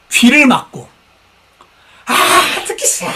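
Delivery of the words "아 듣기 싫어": higher, imitated cry of refusal; mock yell. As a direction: cry out like covering your ears. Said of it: "큰 소리를 지르며".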